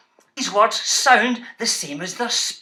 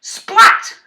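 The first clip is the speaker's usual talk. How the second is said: high, loud splat burst; sudden shout; 11 semitones higher, 7 dB louder than usual. Shown as a demonstration. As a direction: fling the word out like a splash. Big sound word shouted.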